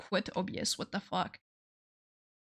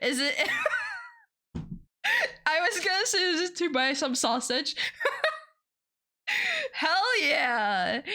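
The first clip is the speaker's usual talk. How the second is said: high giggly delight; excited laughing thanks; 7 semitones higher than usual. Real, not acted. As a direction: squeal your thanks through laughter.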